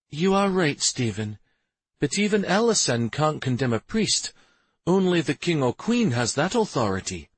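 a hint of a conceding wife; Shari's voice. A narrator's reading. Shari conceding then objecting to her husband, lightly voiced.

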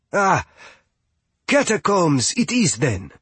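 high, nervous backpedal; a touch of sudden fear. A narrator's reading. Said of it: "Mighty Fia blanched".

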